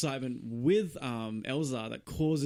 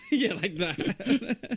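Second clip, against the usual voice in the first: laughing; 9 semitones higher, 3 dB louder, much slower than usual. Real, not acted. Laughing at joke.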